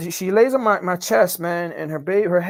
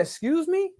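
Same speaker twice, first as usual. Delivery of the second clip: doctor taken aback; 10 semitones higher, 3 dB quieter, slower than usual. Retold or imitated. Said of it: "And he said".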